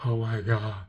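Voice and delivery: hushed, starstruck fan reenactment; acting nervously starstruck, hushed. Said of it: "I get more nervous when I see".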